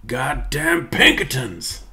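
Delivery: slow, drawn-out American cowboy grumble; cowboy curse. Retold or imitated. The streamer, as Arthur, cursing the Pinkertons in a slow American drawl. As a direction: drag the curse out slowly like an outlaw. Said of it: "my Red Dead Arthur Morgan".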